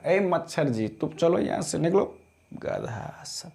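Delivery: muttered aside. Real, not acted. Shooing away a mosquito.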